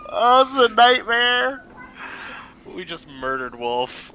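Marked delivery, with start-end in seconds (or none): 0.1-1.6 high, loud exasperation; exasperated. Real, not acted. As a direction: wail it in dismay. Player reacts to party disaster.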